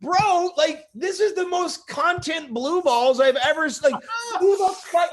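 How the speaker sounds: high, loud exasperated rant; mock outraged friend. Friend Eric complains about the missing ending, loud and high. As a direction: vent like a cheated fan. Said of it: "Eric's just like".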